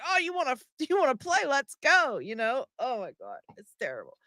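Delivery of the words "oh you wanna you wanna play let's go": playful ram voice. Retold or imitated. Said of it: "they're like".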